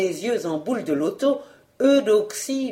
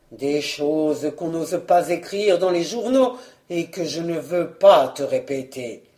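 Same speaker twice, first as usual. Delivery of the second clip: low, reluctant husband; hint darkly in a lowered voice; low, grave stalling; ominous evasion; 4 semitones lower than usual. Acted. Husband stalls with dark hints.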